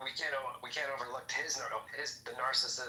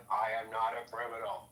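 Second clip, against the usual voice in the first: Nixon impression. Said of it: "Tricky Dicky".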